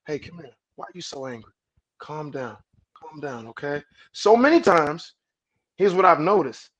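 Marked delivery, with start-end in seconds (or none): 0.1-3.8 soothing calm-down. Retold or imitated. Man calming his upset woman, soft and coaxing. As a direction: talk her down tenderly. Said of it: "to say".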